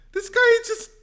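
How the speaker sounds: cheering Yang on against Warren; gleeful excitement. Real, not acted. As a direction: gush with delight. Gleeful reaction.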